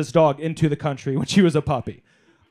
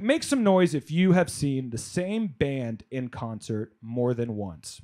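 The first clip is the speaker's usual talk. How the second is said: ordinary talk, nothing marked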